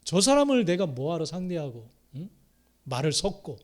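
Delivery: ordinary talk, nothing marked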